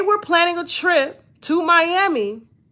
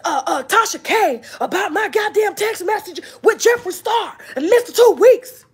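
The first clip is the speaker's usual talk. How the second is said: imitating Von, angry; 4 semitones higher than usual. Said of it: "Then he goes and gets in Chelsea's face".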